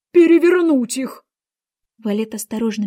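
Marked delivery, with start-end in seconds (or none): 0.1-1.2 loud, high, slow command; shouted order. A narrator's reading. Queen orders the Knave about, shouting imperiously. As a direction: bellow the order, raised and drawn out. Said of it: "крикнула Валету".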